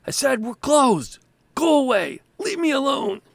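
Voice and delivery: high, loud, fed-up yell; insistent yell, each phrase falling away; shouting shopkeeper. Acted. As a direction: shout to be left alone. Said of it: "shouts, you hear a voice".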